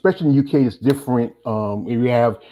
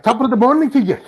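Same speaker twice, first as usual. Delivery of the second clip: put-on Irish accent; 10 semitones higher than usual. Host showing guest the Irish sound, mock Irish accent. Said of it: "compared to the Irish accent".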